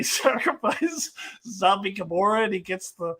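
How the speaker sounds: normal talking voice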